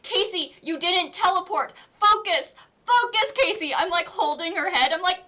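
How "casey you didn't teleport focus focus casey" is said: high, loud retold urging; urgent. To tripping friend Casey, high urgent urging. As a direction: snap her friend back to reality.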